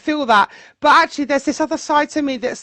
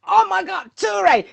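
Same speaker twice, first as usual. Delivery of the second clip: imitating a Jamaican patois shout, higher; Jamaican patois accent; 5 semitones higher than usual. Mimicking Jamaican friends' voice notes in patois. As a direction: call out like an excited Jamaican friend. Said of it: "in their, like, patois accents".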